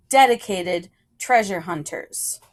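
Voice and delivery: plain reading voice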